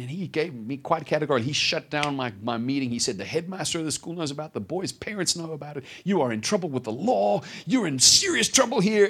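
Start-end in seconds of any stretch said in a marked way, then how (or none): 3.2-9.1 stern retold dressing-down, growing more forceful; laying it on. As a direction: imitate a master piling on the threats. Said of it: "he was just laying it on".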